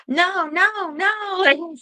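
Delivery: second try at her voice, pitched well up. Retold or imitated. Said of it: "Should I put one on?".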